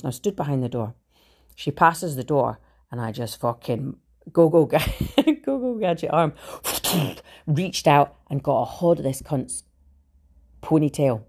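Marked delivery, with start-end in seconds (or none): 4.3-6.3 high, giggly gadget call; playful cartoon catchphrase. Telling how she reached out from behind the door, playful cartoon call.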